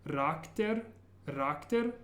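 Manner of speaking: "rakter" said as a piece, rolled r first, without the opening cha; back-to-front build-up. Shown as a demonstration. Teacher working back to front through a long word, piece by piece. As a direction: practise the tail "rakter" by itself. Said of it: "slowly working your way back to front".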